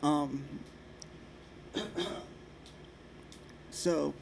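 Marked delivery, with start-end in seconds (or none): 1.7-2.2 sudden choking cough; wrong pipe cough. Real, not acted. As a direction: cough as if water went down the wrong way. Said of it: "I went down the wrong pipe".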